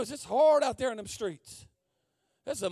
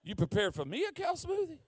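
higher-pitched, disbelieving question; incredulous echo; 5 semitones higher than usual. Retold or imitated. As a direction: repeat the offer as if you can't believe it. Echoes the offer in disbelief.

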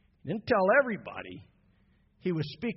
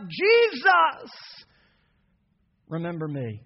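crying out; 3 dB louder, slower than usual. Acted. Dying thief on the cross calling out to Jesus. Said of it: "The crook cried out".

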